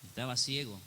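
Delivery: ordinary delivery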